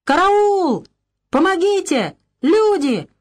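very high, loud panicked screaming; terrified shrieks for help; wild cry for help. A narrator's reading. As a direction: scream for help like a man being drowned. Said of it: "вдруг дико заорал".

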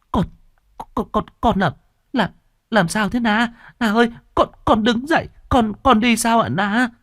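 high, stammering disbelief; grandma can't get the words out; stunned stammer. Acted. Grandmother seeing granddaughter walk, high stunned stammer. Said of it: "làm cho bà cụ choáng váng".

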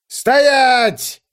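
high, loud command; booming shout. A narrator's reading. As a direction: bark the halt order. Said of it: "прогремел голос".

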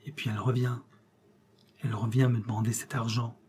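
hushed, soft confiding voice; low, subdued murmur. A narrator's reading. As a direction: lean in and confide it softly. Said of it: "il dit tout bas".

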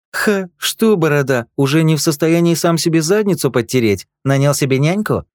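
high, loud jeering; mocking taunt. Fat Dudley mocks Eugene about his babysitter, jeering high. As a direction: laugh at him with scorn.

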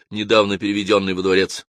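usual speaking voice

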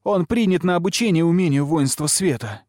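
heated cry of protest; shouted retort. A narrator's reading. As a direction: call it out urgently, pitched high. Lilia shouting back.

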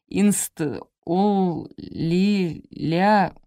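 "инст-, ол-, ли-, ля-" broken into separate drawn-out syllables; stumbling over word. A narrator's reading. Guard struggles with a long word, stumbling on "инст-, ол-, ли-, ля-". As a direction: grope through "инст-, ол-, ли-, ля-", pausing after each piece.

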